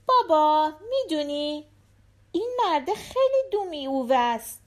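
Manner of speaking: put-on high-pitched little girl chatting to her dad; little daughter. A narrator's reading. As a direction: voice a young daughter, pitched well up. Narrator voices the daughter. (صدای زیر دختربچه)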